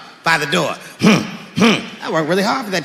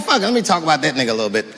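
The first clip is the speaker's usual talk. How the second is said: fast reckless aside; 6 semitones lower, 3 dB quieter, much faster than usual. Retold or imitated. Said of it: "And I figured".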